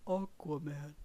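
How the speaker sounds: flat bored monotone; stale voice. Retold or imitated. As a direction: say it lifeless and stale. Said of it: "in a really stale voice".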